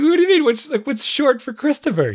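mock-confused teen blurting it out; baffled son. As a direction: sound genuinely lost about his own name. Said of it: "He's like".

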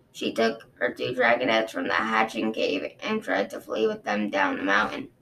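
low, measured guard recounting; a dragon guard explaining to his queen, low and sober; Osprey, low and grave. Acted. Osprey voiced in story.

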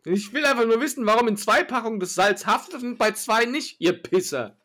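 angry customer. Pretend. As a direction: demand an answer, fed up.

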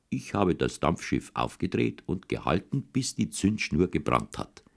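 usual speaking voice

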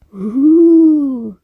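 loud, high drawn-out "huuu"; mischievous hoot. A narrator's reading. Ghost taunting the pupils, mischievous hoot. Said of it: "katanya sambil tertawa nakal".

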